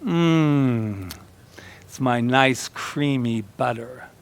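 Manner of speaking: slow, savouring commercial character; lightly voiced, unhurried pleasure; Mother Nature. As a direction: linger over it, softly relishing. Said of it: "And she goes".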